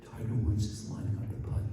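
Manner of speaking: soft, breathy TV golf announcer hush; hushed golf commentator. Retold or imitated. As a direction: whisper it like a golf broadcaster at the putt. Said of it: "they narrated in this Sada Sada voice".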